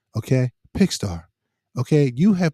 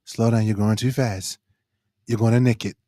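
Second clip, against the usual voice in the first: mock ASMR; 7 semitones lower than usual. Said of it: "A S M R with cucumber".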